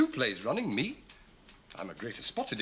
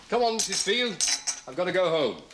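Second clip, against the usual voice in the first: raised, high summons; calling out; 8 semitones higher, 5 dB louder than usual. Acted. Visitor calling the cleaner to leave, loud. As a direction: call across the house to hurry someone.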